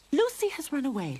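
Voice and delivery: high, loud cross mother; angry. Acted. Mother cat telling her son Lucy ran off, angry. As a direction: scold in a huff. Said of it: "Lorene said angrily".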